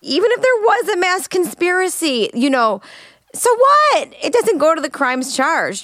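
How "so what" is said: high, loud, scoffing so what; dismissive. Real, not acted. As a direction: toss it off with disbelief. Host dismissing conspiracy point.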